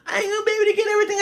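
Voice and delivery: fast, high, tearful mock sob; imitating crying. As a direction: rush it out like a crying sister. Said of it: "She starts crying".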